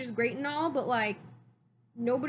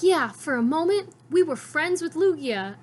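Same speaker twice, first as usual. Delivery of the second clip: proud Ash; 5 semitones higher, 6 dB louder than usual. Retold or imitated. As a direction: brag it like a proud kid, louder. Ash boasting to the professors.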